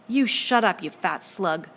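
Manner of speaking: put-on loud, furious snarl at the boy; tempestuous outburst. A narrator's reading. Jack rounds furiously on Piggy, loud angry shout. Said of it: "a shadow fronted him tempestuously".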